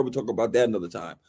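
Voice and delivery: ordinary talk, nothing marked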